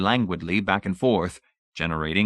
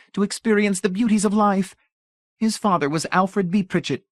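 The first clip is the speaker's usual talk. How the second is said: high, louder rapturous matron; gushing society lady; 11 semitones higher, 4 dB louder than usual. A narrator's reading. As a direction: swoon over great art. Mrs Pritchett gushing about art.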